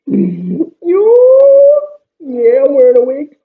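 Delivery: very high filtered laugh and voice; voice changer. Shown as a demonstration. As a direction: laugh through a chipmunk effect. Testing voice-changing headphones.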